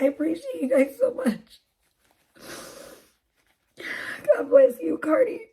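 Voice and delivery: real tearful thanks, voice high and breaking; crying. Real, not acted. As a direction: choke up while thanking them, close to sobbing. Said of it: "you guys are gonna make me cry".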